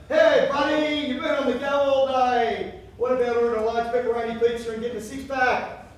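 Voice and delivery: Fat Wayne. Acted. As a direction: tempt him like a greedy mate. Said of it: "Fat Wayne will say".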